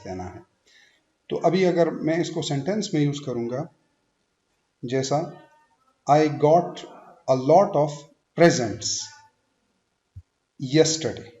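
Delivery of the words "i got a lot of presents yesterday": deliberate phrases, presents stressed on PRE; noun stress. Shown as a demonstration. Teacher reading the noun example, presents stressed on first syllable. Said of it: "as a noun use हुआ है".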